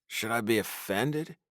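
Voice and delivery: plain reading voice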